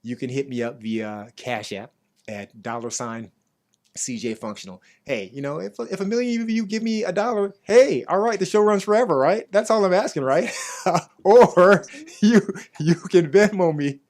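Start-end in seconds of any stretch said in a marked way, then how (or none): 5.6-14.0 higher and much louder, laughing pitch; excited appeal. Real, not acted. Podcast host begging listeners for a dollar, high and loud. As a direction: hype up the audience for a dollar.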